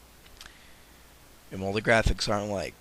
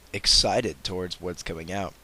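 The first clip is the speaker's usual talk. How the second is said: usual speaking voice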